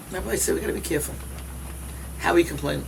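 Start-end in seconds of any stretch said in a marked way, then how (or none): none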